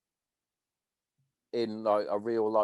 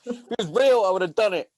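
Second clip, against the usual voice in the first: mock protest; 8 semitones higher, 6 dB louder than usual. Mocking a trainee's protest.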